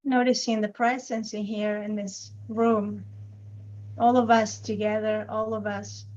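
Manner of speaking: unhurried meditation guide with long silences between phrases; guided meditation. Acted. Teacher guiding a group's attention to the body, calm guided meditation. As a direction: gently lead them into noticing the body, leaving lots of space.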